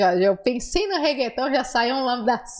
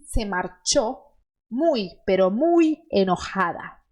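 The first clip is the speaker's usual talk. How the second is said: muy drawn out and leaned on each time; stressed muy; 3 semitones lower, slower than usual. Shown as a demonstration. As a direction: lean on both muy for intensity. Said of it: "pra dar aquela intensidade".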